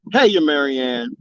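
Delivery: ordinary talk, nothing marked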